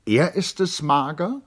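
high, loud, bewildered lady's voice; startled, rushed question; flustered Charlotte. Acted. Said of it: "fragte sie verwirrt". (verwirrt, deutlich höher und lauter)

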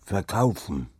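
lightly voiced sly fellow; sly horse seller. A narrator's reading. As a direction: answer like a cunning dealer. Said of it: "sagte jener und nickte mir listig zu". (listiger Pferdehändler, leicht angedeutet)

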